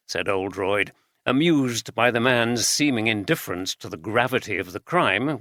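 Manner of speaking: ordinary delivery, nothing marked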